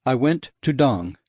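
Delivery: usual speaking voice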